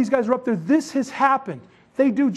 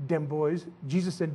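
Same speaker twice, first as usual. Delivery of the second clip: Belizean Creole; 6 semitones lower, 8 dB quieter than usual. Retold or imitated. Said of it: "that's how they say Jesus and the apostles".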